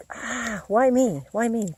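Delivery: hammed-up complaining why me; mock self-pity. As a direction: whine it like a sorry victim.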